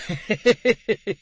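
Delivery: pleased, self-satisfied chuckle; pompous man's hearty laugh. Acted. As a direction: laugh at his own joke. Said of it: "Mister Bumble had laughed".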